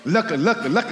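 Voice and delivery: ordinary talk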